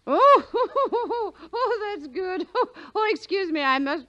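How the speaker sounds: high, loud peals of laughter breaking up the words; cackling with delight at his quip; laughing hard. Acted. Hostess laughs at the detective's machine gun joke, shrieking with laughter.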